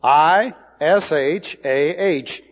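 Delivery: normal talking voice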